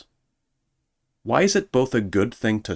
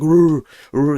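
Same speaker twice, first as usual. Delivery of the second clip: growled rrr sounds; mock growl; 6 semitones higher, 7 dB louder than usual. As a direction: growl like a tough guy. Growling imitation.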